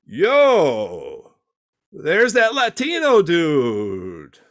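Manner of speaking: long, drawn-out holler; mock street call. Acted street call to Jesus.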